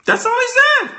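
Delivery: squeaky high voice; comic falsetto; high-pitched mock. Shown as a demonstration. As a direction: jump way up into falsetto on That's all he said.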